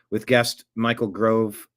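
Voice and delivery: ordinary talk, nothing marked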